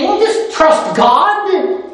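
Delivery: high, drawn-out mock pious advice; hammed-up sanctimonious brush-off; dismissive piety. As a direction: brush them off with a slow, high-pitched platitude. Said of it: "He wasn't dismissive of them saying".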